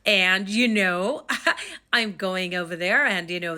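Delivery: put-on drawn-out, loud parody of an American accent; mock American accent. Jamaican vlogger showing how she doesn't sound, mock American accent. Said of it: "I do not sound like an American".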